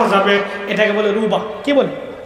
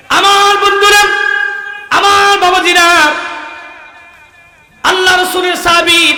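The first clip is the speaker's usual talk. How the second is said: ringing sermon appeal; 9 semitones higher, 3 dB louder than usual. Acted. Preacher addresses friends about the Prophet's companion, high ringing appeal. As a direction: call out to the whole congregation.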